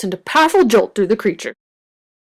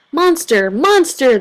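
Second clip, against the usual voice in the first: higher, louder cheer; 6 semitones higher, 4 dB louder, slower than usual. A narrator's reading. The trio cheering as they chase Monster, higher. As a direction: call after him in delight. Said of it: "they cheered after him".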